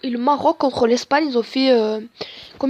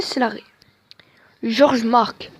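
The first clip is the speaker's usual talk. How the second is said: normal talking voice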